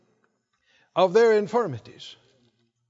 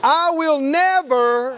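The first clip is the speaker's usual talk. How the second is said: slow, raised-pitch proclamation; out loud, like you mean it; 5 semitones higher, 5 dB louder, much slower than usual. Shown as a demonstration. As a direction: lead the congregation with drawn-out conviction. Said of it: "say it like you mean it".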